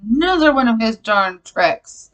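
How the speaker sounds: loud, high exasperated outburst; retold anger. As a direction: re-enact being really mad at Satan. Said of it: "I got really mad at Satan".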